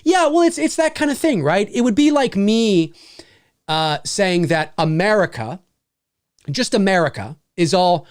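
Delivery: normal talking voice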